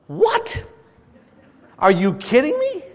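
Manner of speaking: mock disbelief. Diner told the signature dish is gone, mock shock.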